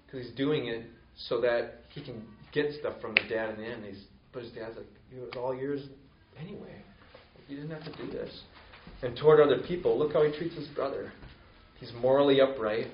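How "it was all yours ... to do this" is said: much softer, gentle reassurance; father gently telling the resentful son it was always his; soft fatherly reply. Acted. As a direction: say it quietly, as a loving father would. Father gently answers the older son.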